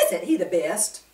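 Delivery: ordinary delivery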